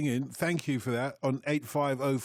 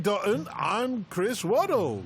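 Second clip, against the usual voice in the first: Stars in My Eyes host; 5 semitones higher, slower than usual. Pretend. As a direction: announce it like a talent show host, drawn out and higher. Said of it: "and tonight".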